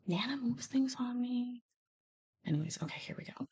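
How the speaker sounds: breathy muttered aside; whisper. Real, not acted. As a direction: whisper it to yourself while searching.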